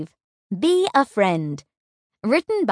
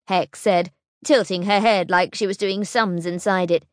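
ordinary delivery

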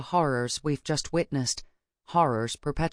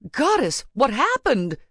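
high, loud shocked question; alarmed; 9 semitones higher, 5 dB louder than usual. A narrator's reading. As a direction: cry out in alarm. Shocked professor sees the scene.

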